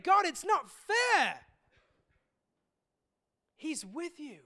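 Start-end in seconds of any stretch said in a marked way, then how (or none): 0.0-1.5 higher, louder, slow anguished cry. Retold or imitated. Hurting believer crying to God, loud slow anguish. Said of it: "kind of crying out within your spirit".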